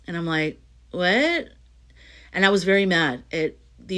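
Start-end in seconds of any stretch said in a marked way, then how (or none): 0.9-1.5 high, rising disbelief; mock disbelief, pitched up into a question; incredulous what. Speaker replies to the universe taking her work away, incredulous. Acted shocked reply to the universe.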